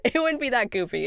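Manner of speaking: a self-conscious giggle through the line; half-laughing correction; giggly. Real, not acted. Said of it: "I just can't do the voice acting".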